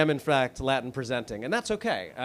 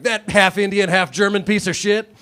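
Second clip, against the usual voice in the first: loud, jeering mock insult; 6 semitones higher, 10 dB louder than usual. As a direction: bellow it like a street bigot. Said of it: "someone's like".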